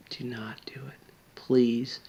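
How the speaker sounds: urgent whisper, voice dropped right away; whispered plea. Real, not acted. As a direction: beg them in a hushed whisper. Pleading with suicidal viewer.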